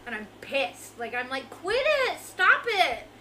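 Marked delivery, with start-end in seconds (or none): 1.5-3.1 high, loud mock protest; mad kid yelling. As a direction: re-enact a kid yelling at dad. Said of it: "Like, I'm mad".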